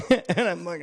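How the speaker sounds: ordinary talk, nothing marked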